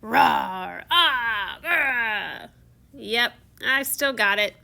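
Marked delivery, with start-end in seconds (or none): 0.0-0.8 deep full-throated roar; beastly roar. Acted. The Beast shows off his roar. 1.6-2.5 growl. Acted. Beast showing off his growl.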